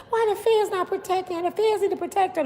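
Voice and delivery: high, fast mimicked complaint; hammed-up high-pitched fretting; mocking imitation. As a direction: parrot silly people in a high rush. Mimicking people's demand for protection.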